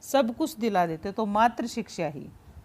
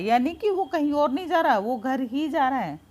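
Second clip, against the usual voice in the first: usual speaking voice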